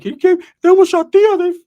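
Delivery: high loud furious shout; Ricky yelling at the host; angry yell. Retold or imitated. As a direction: scream the accusation.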